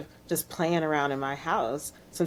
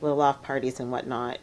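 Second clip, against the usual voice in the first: ordinary talk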